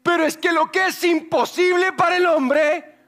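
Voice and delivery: high, loud building to the climax; fervent preaching. Acted. Preacher builds up to the congregation, fervent. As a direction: preach it with fire.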